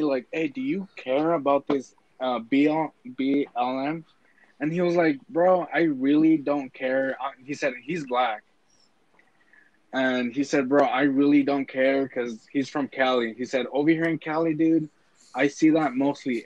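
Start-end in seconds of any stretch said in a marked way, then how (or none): none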